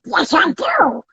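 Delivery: high squawky duck voice; Donald Duck voice. Retold or imitated. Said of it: "So you do a Donald Duck voice".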